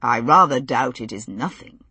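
dry and understated. A narrator's reading. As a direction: deliver it bone dry. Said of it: "he said dryly".

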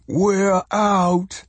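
high, overjoyed shout near tears; joyful shout. A narrator's reading. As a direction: cry out with joy, almost sobbing. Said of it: "he shouted".

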